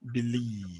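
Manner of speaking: light BE, long LIEVE in believe; stress on second syllable. Shown as a demonstration. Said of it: "obviously it has to go there now".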